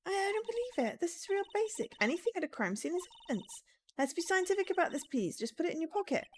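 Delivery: put-on high-pitched, earnest young investigator voice; insistent game character. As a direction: lecture a colleague in a high character voice. Voices Ema lecturing Mister Wright.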